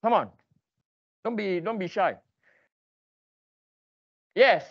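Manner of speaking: ordinary talk, nothing marked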